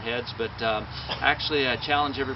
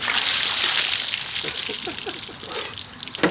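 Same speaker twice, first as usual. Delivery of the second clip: high breathless gasping laugh; gasp-laugh at the freezing shock; shocked laugh from ice water; 6 dB louder than usual. Real, not acted. Ice water dumped on him.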